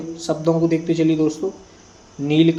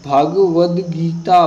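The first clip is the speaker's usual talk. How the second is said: Bhagavad Gita said slowly and louder, syllable by syllable; slow dictation; 6 dB louder, much slower than usual. Shown as a demonstration. Teacher dictating Bhagavad Gita to students, slow and louder. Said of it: "मैं शुद्ध बता रहा हूँ".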